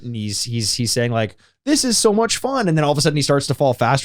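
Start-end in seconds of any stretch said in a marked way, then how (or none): 1.6-2.7 high, cheery little flower voice; sing-song game-flower chirp; chirpy flower voice. Retold or imitated. Flower voice quoted in Mario.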